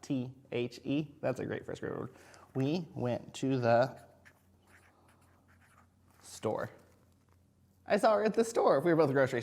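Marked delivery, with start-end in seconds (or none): 0.0-1.1 slow letter by letter; spelling out letters. Shown as a demonstration. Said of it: "do you know how to spell the". 2.6-6.7 very slow, word by word; slow read-back. Shown as a demonstration. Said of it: "So I wrote".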